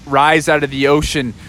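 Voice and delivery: ordinary delivery, nothing marked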